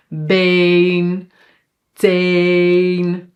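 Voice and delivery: BEEN and TAIN with a long held ay. Shown as a demonstration. Teacher gives examples of long E, held level. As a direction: stretch BEEN, TAIN as bayn, tayn, on a level pitch. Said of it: "like long".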